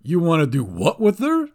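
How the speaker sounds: incredulous goblin; appalled goblin squawk. A narrator's reading.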